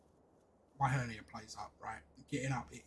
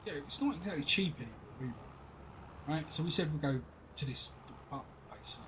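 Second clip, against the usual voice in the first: normal talking voice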